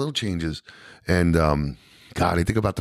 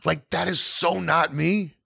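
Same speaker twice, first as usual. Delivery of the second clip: usual speaking voice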